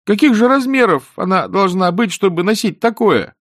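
high, loud, petulant complaint; complaining youngster. A narrator's reading. Boy grumbles to the wizard about the lady's taste, petulant. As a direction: whine indignantly in a high boy's voice. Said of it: "пожаловался Эрик".

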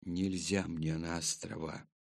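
low, softened near-whisper; hushed, low-voiced reply. A narrator's reading. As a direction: murmur it low, as a frightened secret kept quiet. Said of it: "прошептал мальчик".

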